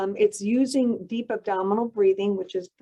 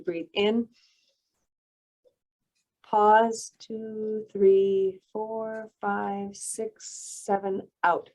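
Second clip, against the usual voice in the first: breathing demo count; much slower than usual. Shown as a demonstration. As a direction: count the breath slowly and calmly. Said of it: "So I'll demonstrate it".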